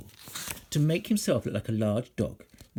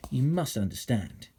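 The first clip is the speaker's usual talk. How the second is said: ordinary delivery, nothing marked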